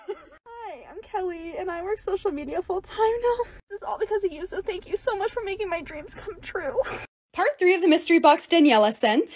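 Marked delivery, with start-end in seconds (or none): 0.0-6.8 tearful thanks. Real, not acted. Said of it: "let me compose myself".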